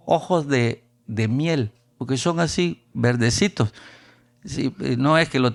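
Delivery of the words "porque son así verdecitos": ordinary talk, nothing marked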